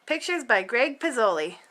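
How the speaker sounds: ordinary delivery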